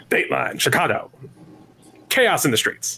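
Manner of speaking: lightly voiced Connecticut newsman announcing; mock Mid-Atlantic newsreader. Shown as a demonstration. Asked by a co-host, a lightly voiced Connecticut newsreel line. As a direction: read it like an old newsreel anchor in the Connecticut accent. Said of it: "I want you to say Dateline Chicago".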